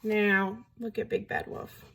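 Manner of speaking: usual speaking voice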